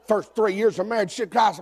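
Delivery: ordinary talk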